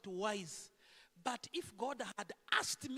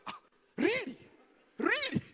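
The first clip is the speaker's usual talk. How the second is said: much higher, louder astonished really; shocked mourner; 11 semitones higher, 10 dB louder than usual. Acted. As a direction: jolt out of grief in disbelief. Mourner hears of unpaid loan.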